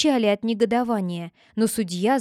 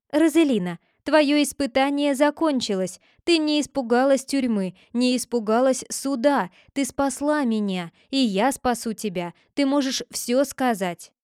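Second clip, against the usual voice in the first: usual speaking voice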